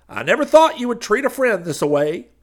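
loud, high glad-handing preacher voice; high preacher figure. Acted. Street preacher pitching to the crowd, loud and high. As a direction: boom it out like a street preacher selling salvation.

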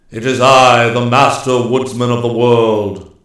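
very loud, booming self-announcement; commanding proclamation. A narrator's reading. Immortal answers the hostile king, loud and commanding. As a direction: proclaim your title with full authority.

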